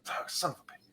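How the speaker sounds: soft, whispered mock cursing; whispered curse. Priest desperate to use the bathroom, whispering a curse. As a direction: curse under your breath as the character.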